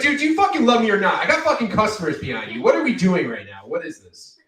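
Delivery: high, loud and fast mock exasperation; exasperated rant. Barista demanding an answer with customers waiting behind, exasperated. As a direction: rant at him to hurry up. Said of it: "I was like".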